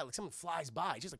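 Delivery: ordinary talk, nothing marked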